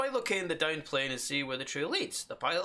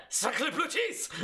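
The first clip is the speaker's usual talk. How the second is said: slow, giggling hammed-up French exclamation; mock French accent through laughter; laughing mock French; 11 semitones higher, much slower than usual. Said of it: "It's more of the John Cleese".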